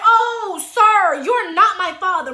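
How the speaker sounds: retold sassy correction; indignant retort. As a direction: put him in his place, loudly. Acted retort to the man.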